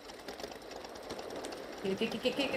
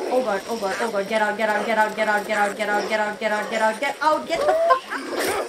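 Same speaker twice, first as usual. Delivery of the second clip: loud, urgent panicked repetition; frantic yelling; 5 dB louder than usual. Real, not acted. Gamer fighting off a zombie, loud frantic yelling. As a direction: shove it out over and over in terror.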